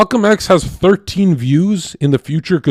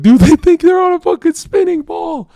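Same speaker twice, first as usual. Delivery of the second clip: much higher, louder mocking voice; mocking laugher; 6 dB louder than usual. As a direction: ham up a jeering onlooker in tears. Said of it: "They're like".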